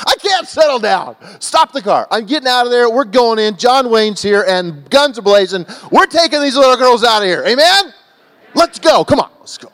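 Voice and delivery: high, mounting shout, re-enacted rage; fired-up outrage. Preacher replaying his outburst at the driver, fired-up shouting. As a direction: shout it like a hero charging in.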